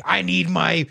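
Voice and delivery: high, strained mock yell; hammed-up high-pitched demand; imitated yelling. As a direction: wail it like a smashed arrestee. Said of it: "like, yelling".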